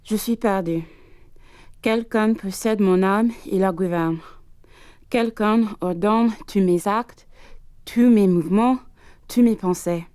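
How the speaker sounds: tormented diary read in French; dramatic French reading. Acted. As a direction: read it as a haunted man's confession.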